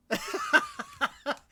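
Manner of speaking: very high, squealing laugh; a shrill burst of giggles at herself; squeaky high giggle. Real, not acted. Laughing at own impression.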